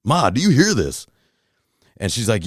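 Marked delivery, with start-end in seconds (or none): 0.0-1.1 high-pitched call to mom, only mildly louder; retold raised voice. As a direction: retell talking over a loud noise to mom. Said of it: "had to start talking louder".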